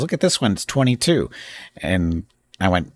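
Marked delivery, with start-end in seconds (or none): 0.0-1.3 higher, lightly voiced excited wife; eager wife. Wife showing husband a dog video, lightly mimicked eagerness. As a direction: imitate her eager showing-off.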